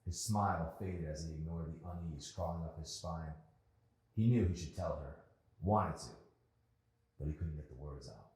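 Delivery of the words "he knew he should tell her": ordinary delivery, nothing marked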